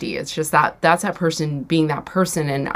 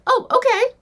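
much higher, squeaky "Oh, okay." forced out by panic; squeaky mock agreement. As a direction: chirp "Oh, okay." in a strained falsetto. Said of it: "so I'd be like".

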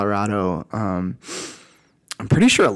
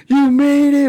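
mock cheer; 5 dB louder than usual. Quoting cheerful hikers at top.

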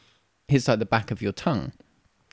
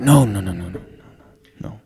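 broken English barman; 4 semitones lower, slower than usual. Retold or imitated. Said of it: "It is broken English".

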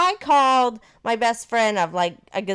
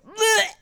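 high-pitched mock retch; imitated dry heave; 13 semitones higher, 4 dB louder than usual. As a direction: act out a sudden gag. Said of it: "dry heaved".

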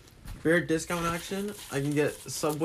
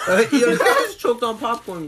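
laughing; 9 semitones higher, 7 dB louder than usual. Real, not acted. Laughing at friend choking.

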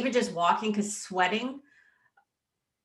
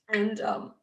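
choked up; 5 semitones higher than usual. Real, not acted. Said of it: "I didn't mean to get emotional".

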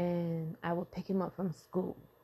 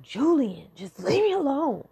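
exasperated snap; 7 semitones higher, 7 dB louder than usual. Retold or imitated. Parent snapping at son.

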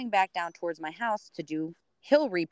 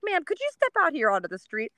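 higher, official police request; cop voice; 9 semitones higher than usual. Retold or imitated. Police friend giving the neighbor a sobriety test. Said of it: "field sobriety test".

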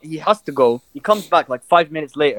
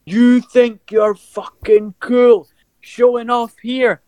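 slow, raised, higher scolding rant; furious sister; 6 semitones higher, much slower than usual. Retold or imitated. Sister tearing into her brother mid-match, slow furious rant. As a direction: sister humiliating her brother in front of everyone, seething. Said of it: "Fucking humiliates the guy".

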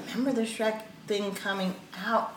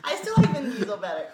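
normal talking voice

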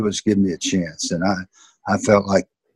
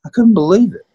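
amazed disbelief; 11 semitones higher, 6 dB louder, faster than usual. Real, not acted. Amazed by teammates' kindness.

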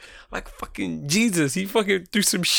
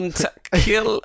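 mock German accent; 6 semitones higher, 4 dB louder than usual. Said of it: "when he put on the German accent".

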